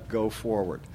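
usual speaking voice

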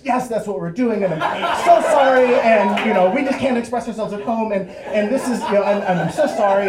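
high, loud, gushing apology; eager false confession. Acted. Boys seizing on the cop's wrong guess, frantic apology. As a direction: latch on desperately, tumbling over the words. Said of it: "we just latched onto this".

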